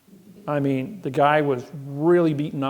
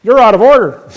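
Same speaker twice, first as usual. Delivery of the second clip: higher, loud mock judge shout; judge perking up; 8 semitones higher, 17 dB louder than usual. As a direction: play the judge snapping back the same line, hammed up. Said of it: "Then the judge perks up and says".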